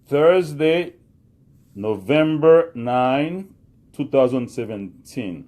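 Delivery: teacher repeating the date for the class, slow and clear; slow repetition. Shown as a demonstration. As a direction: repeat the date slowly for learners. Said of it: "repete li".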